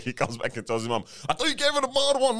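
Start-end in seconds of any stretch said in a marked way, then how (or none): 1.3-2.4 much higher, louder indignant son; high retold complaint. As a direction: squeak out the protest.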